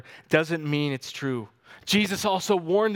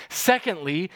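ordinary talk, nothing marked

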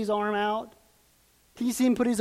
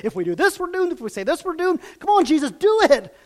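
huddling accusers; 8 semitones higher, 6 dB louder, faster than usual. Retold or imitated. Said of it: "they say".

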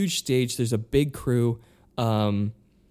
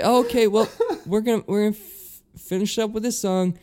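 nervous wrap-up; 10 semitones higher, 4 dB louder than usual. Retold or imitated.

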